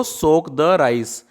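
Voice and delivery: soak the rice, drawn out slowly; slow drill repeat; slow model phrase. Shown as a demonstration. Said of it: "चावल भिगो दो". (धीरे धीरे दोहराना)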